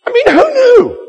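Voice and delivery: disbelieving laugh-cry; amused surprise. Real, not acted. Preacher reacting to God's stark words, cracking with surprise.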